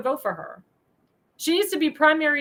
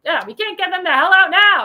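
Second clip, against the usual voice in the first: loud, high, fed-up outburst; real anger boiling over on the repeat; furious shout of frustration; pissed off; 4 semitones higher, 8 dB louder than usual. Real, not acted. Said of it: "I can't even speak 'cause I'm pissed".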